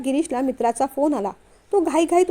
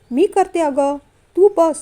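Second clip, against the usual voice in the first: insistent offer; 4 semitones higher, 5 dB louder, slower than usual. A narrator's reading. Aunt soothing an upset Anandi.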